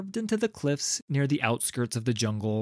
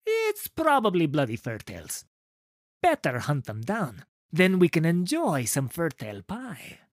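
higher put-on soldier voice; guard character voice; 6 semitones higher, 3 dB louder than usual. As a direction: voice a grumbling guard, pitched up. Man answers the disguised Nico.

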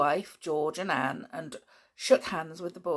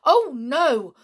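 louder, higher, rushed protest; alarmed; 6 semitones higher, 8 dB louder than usual. Acted. Husband alarmed at his wife coming to stay, hurried protest. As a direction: wave her off in alarm. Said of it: "Her husband looked alarmed".

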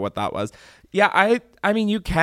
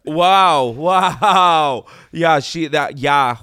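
loud, higher-pitched astonished wows; hammed-up shock, pitched up; mock amazement; 5 semitones higher, 8 dB louder, much slower than usual. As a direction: play the stunned viewer, loudly. Acts out a shocked viewer.

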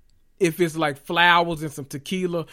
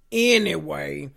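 ordinary delivery

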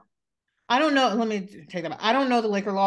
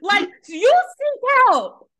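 outraged comeback; 9 semitones higher, 5 dB louder than usual. Acted. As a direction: spit the insult back in full indignation. Imagined furious comeback to a text.

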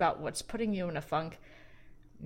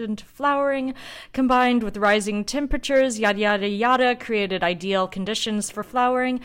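loud, formal report read; reading report aloud; 4 semitones higher, 8 dB louder than usual. Acted. Host reading the winemaker's vintage report to listeners, formal read-aloud.

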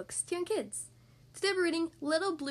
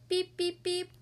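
playful beep beep horn; truck horn beeping; 5 semitones higher, slower than usual. Pretend. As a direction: toot it like a toy truck. Truck honks in story.

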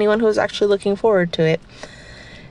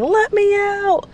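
high, slow pretend puppy voice; dog voice; 13 semitones higher, slower than usual. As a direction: voice the gentle puppy asking out. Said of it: "not aggressively barking and saying".

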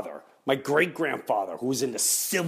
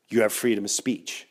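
ordinary talk, nothing marked

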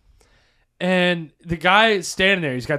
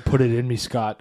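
sultry oven; 5 semitones lower than usual. Retold or imitated. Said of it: "Quiznos commercials, man".

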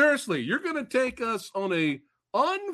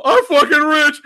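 much higher and louder triumphant cry; celebrating; 8 semitones higher, 12 dB louder than usual. Retold or imitated. The whole family jumping for joy in the movie, shouting loud. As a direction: shout it like you just hit the jackpot. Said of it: "you know".

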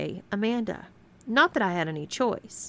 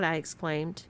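ordinary delivery, nothing marked

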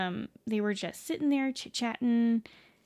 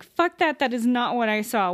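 indignant objection; 2 semitones higher, 6 dB louder than usual. Retold or imitated. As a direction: push back hard on what the kid saw. Friend objects to the kid's account.

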